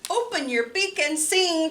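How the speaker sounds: lively picture-book reading with big pitch swings; reading to children. Acted. Librarian reading Hooray for Birds to children, lively and playful. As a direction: read it bouncy and playful for small kids.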